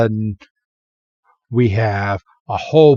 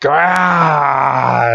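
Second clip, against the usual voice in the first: short, pitched-up cry for a scary panel; mock horror shriek; 2 semitones higher than usual. Narrator voices the ghost's scare in a mock shriek. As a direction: voice the fright sound of the panel. Scary frame sound effect.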